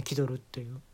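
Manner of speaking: normal talking voice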